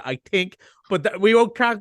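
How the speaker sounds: normal talking voice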